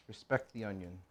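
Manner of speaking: hushed repeat of "Respect the onion"; soft echo. Shown as a demonstration. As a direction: say it again under your breath, reverently.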